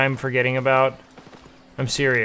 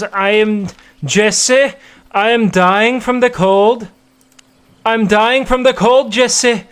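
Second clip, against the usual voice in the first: higher, louder, slow mocking put-on accent; Canute accent impression; 5 semitones higher, 6 dB louder, slower than usual. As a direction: drag out the words like a dramatic foreign streamer. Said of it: "my Canute impression".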